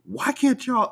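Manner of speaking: high, drawn-out exasperated complaint; exasperated, high-pitched mock scolding; imitating mad father. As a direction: act out a dad fed up with lateness. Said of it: "getting mad at all of us".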